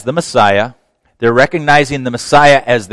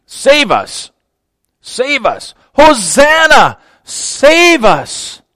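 high, loud crying out to be saved; slow desperate crowd plea; pleading crowd cry; 12 semitones higher, 4 dB louder, much slower than usual. Retold or imitated. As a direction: beg for rescue at full voice. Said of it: "Hosanna simply means".

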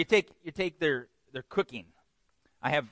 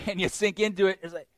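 ordinary talk, nothing marked

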